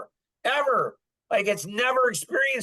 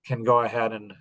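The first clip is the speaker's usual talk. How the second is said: ordinary talk, nothing marked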